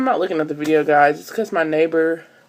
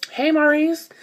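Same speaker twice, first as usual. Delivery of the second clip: bright lilting hello pitched way up; high sweet greeting; 10 semitones higher than usual. Retold or imitated. Vlogger greeting her twelve-year-old cousin, high and sweet. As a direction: greet the kid in a sugary high voice.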